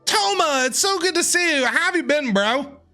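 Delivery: normal talking voice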